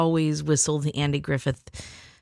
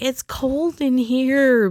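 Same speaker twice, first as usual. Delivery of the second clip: high, slow whining about the cold; high complaining; 8 semitones higher, much slower than usual. Retold or imitated. Walking into the cold classroom, high slow whine. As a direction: drag it out, pitched high. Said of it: "and I'm like".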